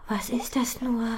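slow, scared whispered question; fearful whisper. A narrator's reading. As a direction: breathe it out, frightened. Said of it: "fragt Butterblume ängstlich". (ängstlich geflüstert)

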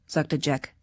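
ordinary delivery, nothing marked

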